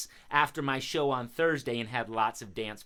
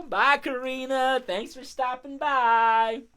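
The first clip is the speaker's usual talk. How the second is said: higher, drawn-out farewell; calling goodbye; 10 semitones higher, 5 dB louder, slower than usual. Pretend. Host seeing the crow off, lifted farewell call.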